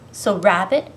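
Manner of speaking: ordinary delivery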